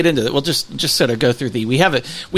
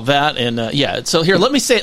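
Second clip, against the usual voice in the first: ordinary talk, nothing marked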